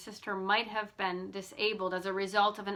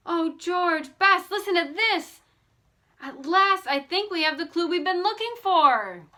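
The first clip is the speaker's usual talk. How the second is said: much higher, louder breathless excitement; thrilled discovery; 8 semitones higher, 7 dB louder than usual. A narrator's reading. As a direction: announce the long-sought clue. Nancy discovers the clue.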